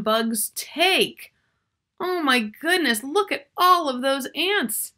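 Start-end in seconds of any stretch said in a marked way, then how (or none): none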